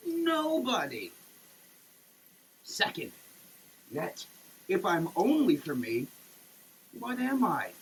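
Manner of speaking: ordinary talk, nothing marked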